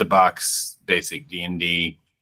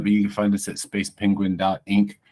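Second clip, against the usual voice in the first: ordinary delivery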